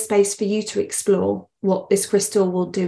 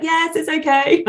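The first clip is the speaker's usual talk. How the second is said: put-on higher, drawn-out reply of a child; imitated son; 7 semitones higher than usual. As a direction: play a son placating his mother. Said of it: "He's like".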